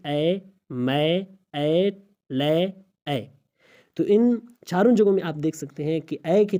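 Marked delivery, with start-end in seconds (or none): 0.1-3.3 اے، میں، اے، لے one by one on an "ae" vowel; isolated "ae" sound. Shown as a demonstration. Teacher showing how to read it, اے، میں، اے، لے separately. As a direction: sound out اے، میں، اے، لے with pauses between. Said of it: "جیسے آپ وہاں پڑھیں گے".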